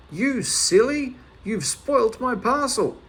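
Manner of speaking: high, loud, slow scolding yell; furious across the stream; angry shout. Acted. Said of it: "shouted once more".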